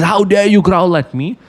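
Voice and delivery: lion snaps at his own reflection, lightly voiced; indignant lion. Lion challenging his reflection in the well, mild mock outrage.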